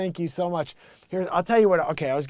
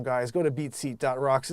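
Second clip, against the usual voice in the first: ordinary talk, nothing marked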